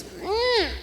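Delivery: high crooning Lordy, Lordy, Lordy; high sing-song Lordy. Retold or imitated. Third porch sitter adding to the Lordy chorus, high croon. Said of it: "somebody else says".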